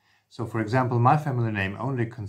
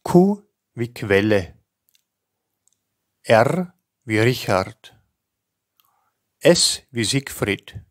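each letter and name spoken slowly and distinctly with pauses; careful spelling dictation; 7 dB louder, slower than usual. Shown as a demonstration. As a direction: dictate it clearly, like spelling over the phone. Teacher reads Austrian alphabet.

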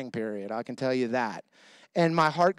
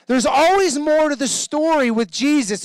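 preaching; 10 semitones higher, 7 dB louder than usual. Acted.

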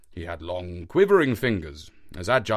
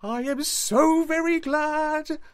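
much higher, slow, overjoyed cry of relief; joyful exclamation; 4 dB louder, slower than usual. A narrator's reading. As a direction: glad to find his lost stick. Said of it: "with an exclamation of joy".

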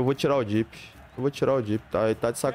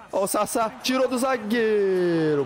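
rising hype, high and drawn out; excited; 8 semitones higher than usual. Real, not acted. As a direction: call the chance as it builds. Striker breaks past defender.

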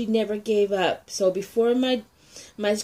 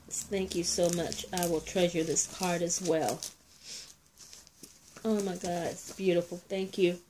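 much softer, choked thank-yous; tearful thanks; 2 semitones lower, 7 dB quieter than usual. Real, not acted. Thanking a friend who lost her mother: soft, tearful gratitude. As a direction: thank her through real tears, almost under the breath. Moved to tears by card.

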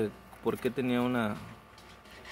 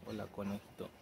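soft talk to bunny; 11 dB quieter than usual. Real, not acted. Softly greeting the baby rabbit.

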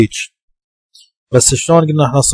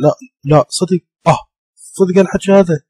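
surprised disbelief; 7 semitones higher than usual. Retold or imitated. Teacher voicing a shocked person to show the vowel, surprised. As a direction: act out someone who can't believe what they heard. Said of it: "مثل واحد يقول".

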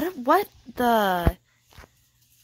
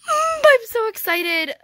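excited squeal; 12 semitones higher, 5 dB louder than usual. Real, not acted. Kid thrilled by a surprise update.

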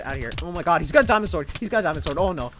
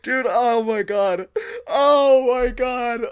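laughing through words; 5 semitones higher, 6 dB louder, slower than usual. Real, not acted. Gamer guilty about bow-spamming, laughing through words. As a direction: talk through helpless high laughter.

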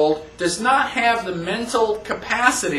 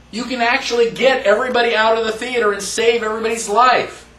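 usual speaking voice

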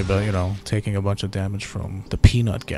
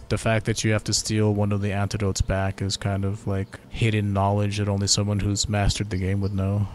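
usual speaking voice

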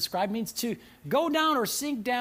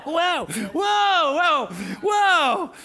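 loud high mock recoil; startled whoa; 6 semitones higher, 5 dB louder, much slower than usual. Pastor backing off from a troubled person, loud mock whoa. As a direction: back away, laughing, louder and higher. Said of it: "You're like".

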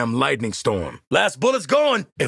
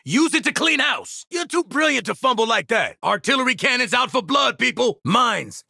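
high, shouted battlefield warnings; urgent combat callouts; 7 semitones higher than usual. Acted. Warning squad under artillery fire, urgent callouts. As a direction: call threats out to the squad.

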